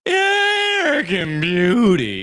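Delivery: slow booming fighting game announcer. Acted. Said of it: "Is this what you think an announcer voice is?".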